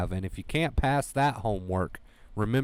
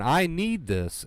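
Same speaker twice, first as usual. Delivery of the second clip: higher and slow, stress on need; slow emphatic affirmation; 6 semitones higher, slower than usual. Shown as a demonstration. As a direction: say it like you mean it, slowly. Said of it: "is so true".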